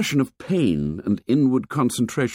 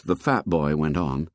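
ordinary delivery, nothing marked

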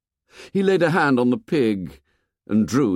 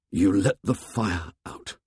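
hoarse; 3 semitones lower than usual. A narrator's reading.

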